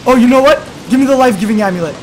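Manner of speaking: loud, fast burst of inspiration; sudden excited idea. Real, not acted. Gamer suddenly remembering the amulet, loud and excited. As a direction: blurt the plan out loud and fast.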